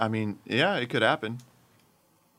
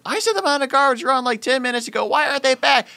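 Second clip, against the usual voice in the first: high, loud, fast irritated demand; exasperated coworker; 12 semitones higher, 4 dB louder than usual. Retold or imitated. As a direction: fire it off, fed up. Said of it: "It's like".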